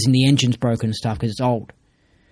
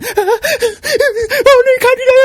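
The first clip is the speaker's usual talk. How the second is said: much higher, laughing mock whimper; mock scared; 7 dB louder, slower than usual. Mocking fearful people's energy, high laughing whimper. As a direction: whimper and sob like a frightened wimp. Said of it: "this is what I perceive the energy from them".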